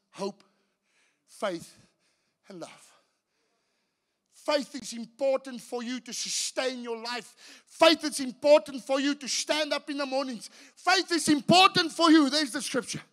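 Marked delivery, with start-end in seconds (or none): 4.3-12.3 from plain to loud, high emphasis; building preaching. Acted. Telling the congregation faith matters, building preaching. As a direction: climb with each faith is important. Rising repeated lines.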